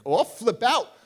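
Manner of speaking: loud, high warning; heated threat. Retold or imitated. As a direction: threaten her, voice jumping up. Angry threat to the counselor.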